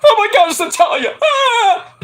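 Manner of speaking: mock the fangirl, shrieking. Vlogger mocking Jade's fangirling, high shriek. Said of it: "Jade fucking fangirling".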